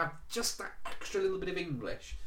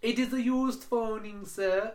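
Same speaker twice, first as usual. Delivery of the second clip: imitated Indian accent; 8 semitones higher, slower than usual. Agent voiced in phone story.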